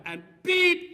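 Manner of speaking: a sharp, high, loud bleep; sudden beep. Pretend. Preacher jokes he might swear mid-sermon with a loud beep. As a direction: blurt the beep out like a slip.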